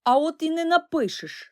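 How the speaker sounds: stubborn angry twin; angry retort. A narrator's reading. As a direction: snap back at your brother. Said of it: "сердито тупнув ногою Такусь".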